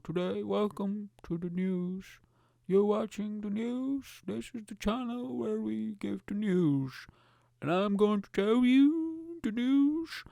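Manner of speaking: slow, high, drawn-out presenter voice; cartoonish dragged-out bulletin; mock newsreader. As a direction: read the news slowly in a silly voice. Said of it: "learn how to do more voices".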